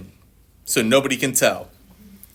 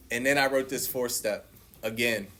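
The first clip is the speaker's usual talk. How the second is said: ordinary delivery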